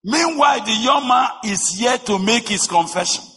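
high, loud impassioned preaching; preaching at full force. Acted. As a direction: drive the story home from the pulpit.

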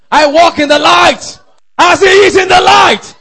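high, loud declaration of faith; exultant shout. Real, not acted. Preacher's declaration builds to shouting.

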